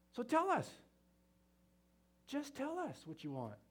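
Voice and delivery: high-pitched, exasperated mock plea; pleading. Husbands begging wives to say what they want, pleading. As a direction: pitch "tell us" way up, imploring.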